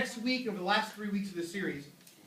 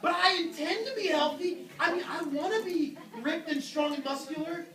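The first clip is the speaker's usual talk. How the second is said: protesting self; 9 semitones higher, 3 dB louder than usual. Pretend. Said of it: "You would go".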